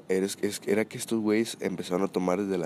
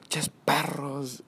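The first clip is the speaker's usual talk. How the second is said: put-on slow, high, mushy drunken insult; mock drunk slur, high and drawn out; 6 semitones higher, 3 dB quieter, much slower than usual. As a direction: mimic a wasted friend mumbling it out. Drunk friend slurring insult.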